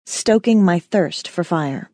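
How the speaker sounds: ordinary delivery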